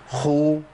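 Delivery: خو with breath running through the خ; خاء مضمومة مهموسة; kha with damma. Shown as a demonstration. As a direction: say خو with audible air on the خ. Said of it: "ركزوا معايا تاني".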